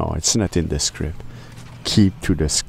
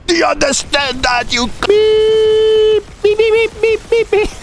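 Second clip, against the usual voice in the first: shouted rant; 6 dB louder, much slower than usual. Acted. Mock rant with own censor beeps.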